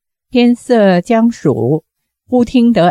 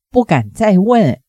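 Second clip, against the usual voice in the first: ordinary delivery, nothing marked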